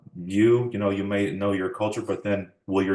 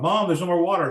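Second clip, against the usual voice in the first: calling out; 10 semitones higher, 5 dB louder than usual. Retold or imitated. Child calling to mom.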